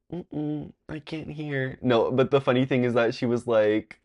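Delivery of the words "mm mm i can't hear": small, soft, high voice; faked helplessness. Prankster pretending to the girl he cannot hear, faked helplessness. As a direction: play innocent, softly and high. Prankster faking deafness to classmate.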